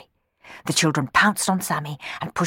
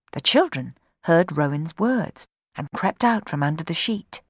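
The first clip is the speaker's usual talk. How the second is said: ordinary delivery, nothing marked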